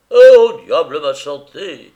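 loud irritated outburst; mauvaise humeur. A narrator's reading. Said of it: "S'écria Velchnaninov avec mauvaise humeur". (s'écrier avec mauvaise humeur, très fort)

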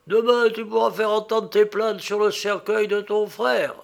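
high, loud ghostly prophecy; a hint of a grim phantom. A narrator's reading.

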